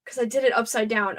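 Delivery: ordinary talk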